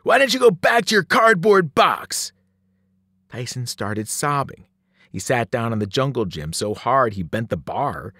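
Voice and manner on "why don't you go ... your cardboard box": high, loud bully's jeer; loud, punched-out taunt; yelled taunt. A narrator's reading. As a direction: yell it across the playground. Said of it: "Sloan yelled".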